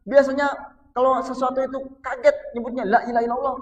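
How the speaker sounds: high, loud preaching; emphatic preaching. Acted. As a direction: preach it with force. Preaching at full force. (berkhotbah dengan suara tinggi dan keras)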